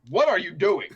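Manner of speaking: a startled judge demanding answers; baffled judge. Acted. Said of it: "He's gonna look at you and say".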